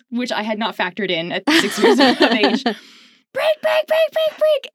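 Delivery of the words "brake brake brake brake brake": very high, loud repeated mock scream; imitated screaming. Six-year-old warning her brother of a runaway toboggan, imitated screaming. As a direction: reenact a panicked child screaming into the wind. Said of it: "So I start screaming into the wind".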